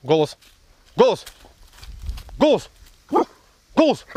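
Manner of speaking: shrill loud "speak" to the dog; high calls to bark; high loud command. Real, not acted. Снова голос.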